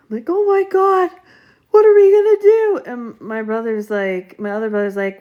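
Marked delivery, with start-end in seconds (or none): 0.3-2.8 high, louder tearful panic; panicked crying. Retold or imitated. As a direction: wail it in fear. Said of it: "like, crying".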